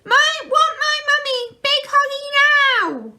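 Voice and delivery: put-on very high, loud, slow baby demand; toddler wailing. A narrator's reading. Baby clinging to mum's bedclothes, high loud wail.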